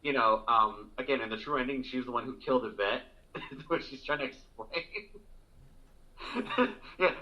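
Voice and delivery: ordinary talk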